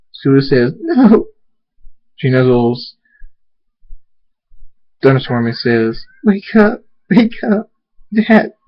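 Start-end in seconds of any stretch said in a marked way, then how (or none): 0.8-1.3 very high, loud "no"; distraught child's wail; anguished denial. Acted. Child refuses to accept death. 6.2-8.5 high, repeated urgent cries; distressed child's plea; desperate pleading. Acted. Child begs father to wake.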